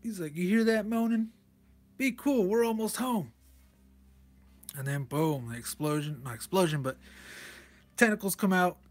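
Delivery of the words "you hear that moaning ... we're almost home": higher-pitched character quote; acted comic-book character. Reading one pilot's line about moaning to another, higher-pitched acting. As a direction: put on a higher voice for the dialogue.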